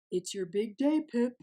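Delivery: hearty papa penguin. Acted. Papa voiced in story.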